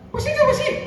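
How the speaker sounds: squeaky mock listener. High put-on interjection.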